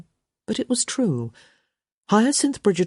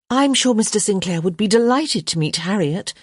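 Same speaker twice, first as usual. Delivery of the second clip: higher-pitched smooth interjection; cutting in; 5 semitones higher, 3 dB louder than usual. A narrator's reading. Young lady cutting in for her companion, assured. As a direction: step in briskly on his behalf. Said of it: "Hyacinth cut in".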